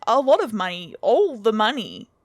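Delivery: loud, desperate bargaining; sputtering. A narrator's reading. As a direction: raise the offer in a frantic burst. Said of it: "I sputtered".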